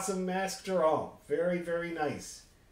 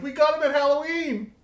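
high, loud mock protest; retold protest; 11 semitones higher, 6 dB louder than usual. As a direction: blurt it out high-pitched, defending yourself. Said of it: "And I was like".

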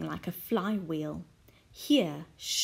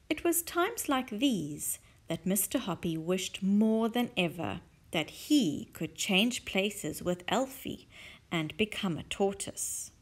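usual speaking voice

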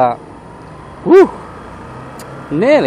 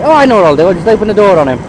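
mocking quote; 4 semitones higher, 6 dB louder, much faster than usual. Mocks the door-opening driver.

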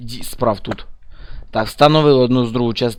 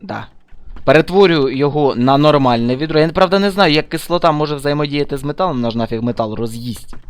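ordinary talk

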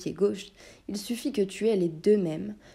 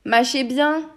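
taunting jab; 6 semitones higher, 8 dB louder, slower than usual. Acted. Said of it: "lança-t-il au magicien".

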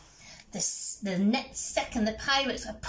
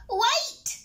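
high, loud cry to halt; urgent cry; 14 semitones higher, 5 dB louder than usual. A narrator's reading. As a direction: cry out to stop them. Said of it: "she cried".

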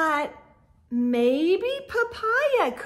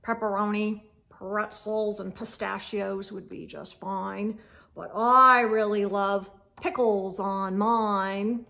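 lower, grumbly toad voice, lightly voiced; a hint of a grumpy toad; 6 semitones lower than usual. Grumpy Toad tells the gang what he loves on pizza, low and grumbly. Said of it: "Grumpy Toad said".

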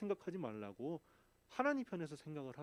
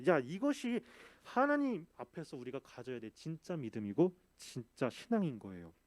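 usual speaking voice

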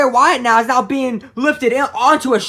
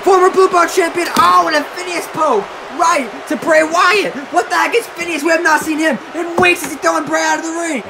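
raised, urgent play-by-play; excited wrestling commentary; 8 semitones higher, 4 dB louder than usual. Acted. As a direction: call the sudden turnaround as it happens. Calls the match.

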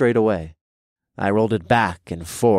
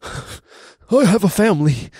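high, slow desperate begging; spluttering plea; 12 semitones higher, 6 dB louder, slower than usual. Acted. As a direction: splutter it out in terror. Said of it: "he spluttered".

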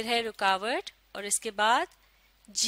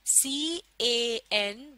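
letters drawn out one by one, very slowly; teacher sounding out C-A-N; slow spelling out; much slower than usual. Shown as a demonstration. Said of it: "आप भी मेरे साथ पढ़िए".

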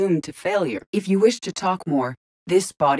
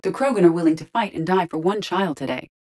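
ordinary delivery

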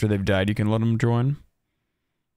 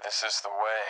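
Mandalorian voice; 9 dB quieter than usual. Pretend. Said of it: "the Mandalorian voice".

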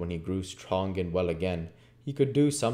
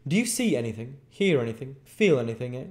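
raised, urgent questioning; bustling doctor; 6 semitones higher, 5 dB louder than usual. Acted. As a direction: fire questions as a fussing doctor. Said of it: "all in a bustle".

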